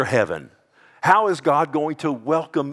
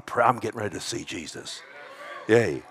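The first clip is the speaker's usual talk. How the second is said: usual speaking voice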